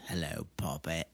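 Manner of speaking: low, soft menacing hello puppet; villain quote. Retold or imitated. Quoting a movie villain's line to the co-host. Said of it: "some villain says".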